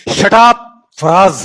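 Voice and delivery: sharp yell of shut up at husband; wife yelling. Retold or imitated. Angry wife yelling at husband Faraz. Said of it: "उनके घर से आवाज आती थी".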